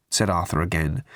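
ordinary delivery, nothing marked

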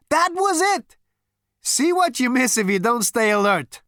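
high, loud triumphant outburst; excited cry. A narrator's reading. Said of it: "cried Zaphod".

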